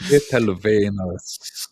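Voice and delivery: Little wieners with a German v, slow; drawn-out German-sounding Little wieners; mock German pronunciation. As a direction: say Little wieners as "lettle venners", slowly. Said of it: "repeating the phrase".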